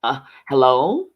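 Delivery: loud hello with a rising end; startled awake. A narrator's reading. Husband answering the phone after dozing off, startled. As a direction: answer the phone as if jolted from sleep. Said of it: "the phone startled him awake".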